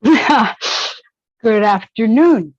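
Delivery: drawn-out, loud hello through a laugh; laughing greeting. Real, not acted. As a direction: stretch it slowly while laughing.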